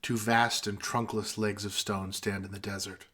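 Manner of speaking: soft, solemn recital; poem recitation. Acted. Reciting Ozymandias to friends in a solemn, soft voice. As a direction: recite it gravely, like Cranston.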